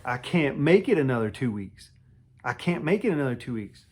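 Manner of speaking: loud tearful plea; desperate cry. Retold or imitated. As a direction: beg as if at breaking point. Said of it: "I'm in tears".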